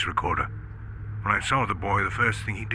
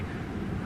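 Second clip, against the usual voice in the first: whispered gasp; 9 dB quieter than usual. Acted. Whispered sighting of small creature.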